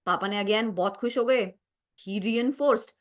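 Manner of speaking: normal talking voice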